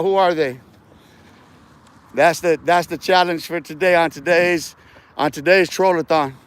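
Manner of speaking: ordinary delivery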